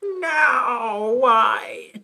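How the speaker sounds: loud crying shout, hammed up; mock drunken wail. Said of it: "I'm drunk".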